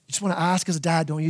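ordinary talk

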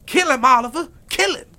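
high, loud screaming order to his son; frantic shout. Acted. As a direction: shriek at Oliver to shoot. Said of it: "Quincy shouted".

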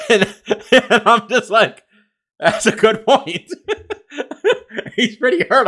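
laughing through the words at his own story. Real, not acted. Podcast host retelling his friend's joke, laughing. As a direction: chuckle out the quote as you tell it.

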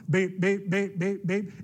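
rapid mock alarm beeps; fast alarm beeps. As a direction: beep it out like an alarm that's speeding up. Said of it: "it speeds up".

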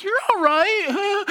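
very high, slow, laughing fake comfort; shaky mock reassurance. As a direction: soothe her while clearly panicking. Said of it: "I'm like".

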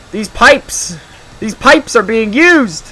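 high, loud, showy declaration; mock-dramatic boast. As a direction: proclaim it grandly, stretching the words. Mock boast about his voice.

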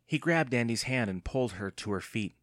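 usual speaking voice

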